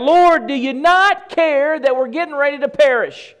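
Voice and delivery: loud, high plea of terrified disciples; desperate cry out. Retold or imitated. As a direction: cry out to the Lord over the storm. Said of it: "And they cried out".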